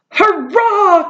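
a joyous cry of victory; cheer. Acted. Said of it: "cried the bugs".

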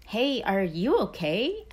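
slow, concerned little frog voice; red frog voice. Acted. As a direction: ask slowly, like a worried frog. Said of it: "asked the red frog".

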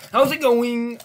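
high, loud cheery greeting; hyped-up greeting. Pretend. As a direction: burst out with a big hyped hello.

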